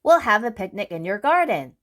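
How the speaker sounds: fast, eager chatter; brisk Mister Busy. Acted. Mister Busy plans a picnic in a rush. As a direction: rattle it off in a hurry.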